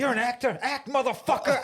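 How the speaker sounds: high, loud mock command; acted shouted goading. Film fan playing the listeners urging the actor, shouting.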